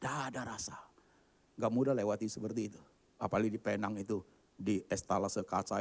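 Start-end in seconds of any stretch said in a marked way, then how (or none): 0.0-0.8 soft, voiceless whisper; whispered lament. Acted. Speaker confiding how tasteless the diet is, whispered lament. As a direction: drop to a despairing stage whisper.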